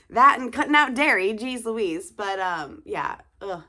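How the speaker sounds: normal talking voice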